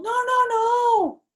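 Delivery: slow, high, loud protest; child yelling at grandpa to stop. Acted. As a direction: shriek no over and over. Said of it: "I yelled".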